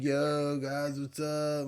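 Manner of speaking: lightly voiced stoned kid; stoner kid greeting. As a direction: play the laid-back kid saying hi. Voicing the kid walking over.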